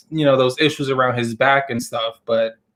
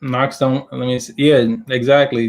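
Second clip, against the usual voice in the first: normal talking voice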